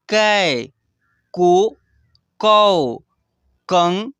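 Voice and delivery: each syllable क, का, कि, की, कु, के, कै, को, कौ, कं said slowly and loudly, one by one with a pause after each; क, का, कि, की, कु, के, कै, को, कौ, कं each pronounced crisply and separately for students to repeat; barakhadi recitation. Shown as a demonstration. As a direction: spell out क to कं clearly like a teacher drilling a class. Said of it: "ऐसे उच्चारण करते".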